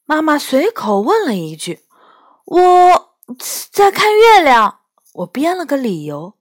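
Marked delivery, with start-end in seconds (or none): 2.4-4.8 put-on childish voice. Child makes up an excuse.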